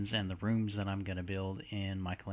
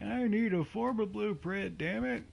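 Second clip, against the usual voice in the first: exasperated whine; 13 semitones higher, 4 dB louder than usual. Retold or imitated. Frustrated outburst, imagined self.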